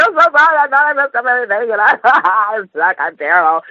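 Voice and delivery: high, half-crying retold apology; retold bawling apology. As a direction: replay the tearful panic, mildly mock-sobbing. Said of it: "I just started bawling".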